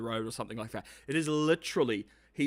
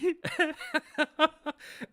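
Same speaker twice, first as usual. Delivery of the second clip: a high giggling laugh; high burst of laughter. Real, not acted. Laughing at the husband's sudden switch, high giggle.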